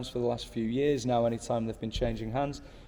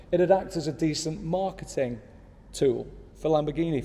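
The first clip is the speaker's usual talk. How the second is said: ordinary delivery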